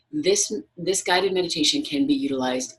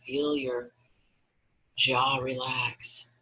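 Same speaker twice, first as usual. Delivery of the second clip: guided meditation. Acted. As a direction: guide them gently into relaxation, unhurried.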